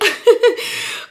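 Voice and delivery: high breathy laugh through crying; tearful laugh. Real, not acted. Vlogger laughing while crying over the email. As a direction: laugh helplessly through tears.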